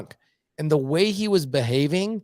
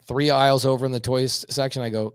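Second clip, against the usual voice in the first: normal talking voice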